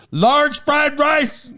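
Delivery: high, drawn-out waitress imitation; mock yell. Waitress double-checking a diner's order, high mock yell. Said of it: "she kinda yelled about it".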